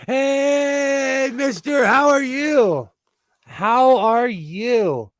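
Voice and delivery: high, loud, drawn-out hello; exuberant greeting. Real, not acted. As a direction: call out a delighted welcome, stretching the words. Greets arriving viewer on livestream.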